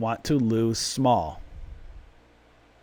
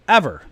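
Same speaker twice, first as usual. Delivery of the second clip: ever punched louder; stress on ever; 3 semitones higher, 6 dB louder than usual. Real, not acted. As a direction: hit the second ever hard. Repeated for emphasis.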